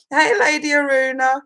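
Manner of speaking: usual speaking voice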